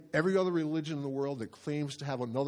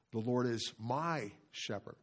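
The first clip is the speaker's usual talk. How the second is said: slow, with MY drawn out and raised; stress on MY; 4 dB quieter, slower than usual. Shown as a demonstration. Pastor showing his verse study, stress on MY. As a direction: hang on "my" before "shepherd". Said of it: "emphasizing each word".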